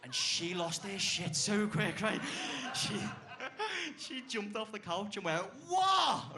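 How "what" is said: a sharp, furious "What?", far higher and louder; aggressive what. Retold or imitated. Girlfriend leaping off the couch at his remark, furious. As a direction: explode off the couch in outrage. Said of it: "Pure aggression".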